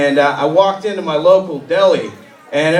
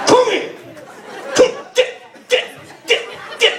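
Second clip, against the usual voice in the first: mock furious yell. Girlfriend shouting threats.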